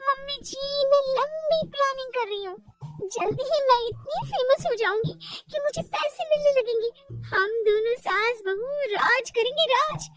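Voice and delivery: put-on high excited young wife boasting of future fame; dreamy bahu. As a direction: spin daydreams of fame for your mother-in-law.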